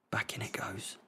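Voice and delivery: very soft whisper; unvoiced whisper. Real, not acted. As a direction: breathe the words. Whispered commentary.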